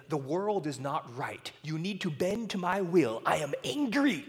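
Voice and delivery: a hint of a furious child; lightly voiced defiant kid; understated imitation of a tantrum; mock angry tantrum. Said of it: "She would cry as in".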